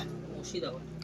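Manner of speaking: breathy whisper, barely audible. Real, not acted. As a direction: answer under your breath. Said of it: "হ্যাঁ?".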